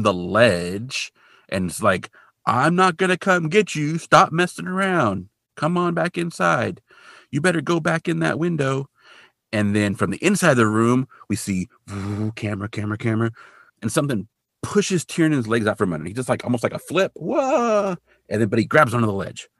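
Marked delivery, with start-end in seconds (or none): 2.4-8.9 high, stretched-out mock calling; exasperated order, acted out in a raised pitch; retold calling out. Retold order shouted from the ledge. 17.2-18.0 high drawn-out "whoa"; mock cry of someone toppling over; imitated falling yelp. Imitates man flipping off ledge.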